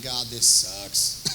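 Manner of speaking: low, very slow and weary; sleepless groan. Retold or imitated. Lying awake at midnight, moaning to God, low and slow. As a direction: drag it out, fed up and tired. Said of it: "I'm saying".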